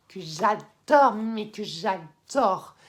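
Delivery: "j'adore" drawn out slowly, both times; slow, heavy stress on "j'adore"; emphatic gushing. Real, not acted. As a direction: stretch and lean hard on each "j'adore". Gushing emphasis on loved manga.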